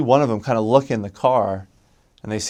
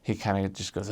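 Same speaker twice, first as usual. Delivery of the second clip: usual speaking voice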